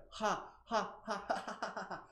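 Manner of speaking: high has speeding up into a laugh; ha turning into laugh. Shown as a demonstration. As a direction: start as spaced exhales, tumble into laughter. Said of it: "a laugh. So it's gonna be like".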